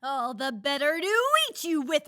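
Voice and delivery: sly wolf building to a cry. A narrator's reading. Wolf revealing himself to Red, menace rising to a cry. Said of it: "cried the wolf".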